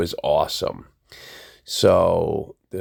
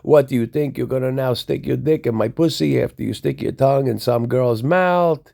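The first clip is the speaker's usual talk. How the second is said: raised, higher mock yelling at him; retold screaming; 7 semitones higher than usual. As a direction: re-enact an angry girlfriend's tirade, pitched up. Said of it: "She's screaming at me in the cab".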